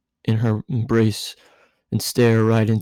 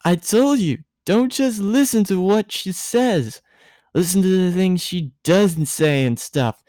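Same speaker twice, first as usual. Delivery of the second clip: put-on mumbling drunk Misato; 8 semitones higher than usual. A narrator's reading. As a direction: lecture him like a sleepy drunk. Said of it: "Misato mumbled into her arms".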